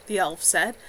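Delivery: ordinary delivery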